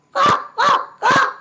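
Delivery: very high, loud squawking quacks; raven's call. A narrator's reading. Raven calling to tease the hungry cub. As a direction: squawk it like a bird. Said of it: "came a raven's call".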